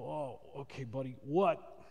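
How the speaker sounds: low, soft, just-woken reply; sleepy murmur rising into a question; groggy parent. Acted. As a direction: answer a child half asleep, acted. Acting parent woken by child.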